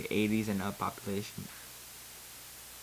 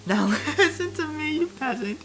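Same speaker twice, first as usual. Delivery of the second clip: high, laughing menace; mock-villain threat with a cackle; threatening; 6 semitones higher than usual. A narrator's reading. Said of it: "I threatened him".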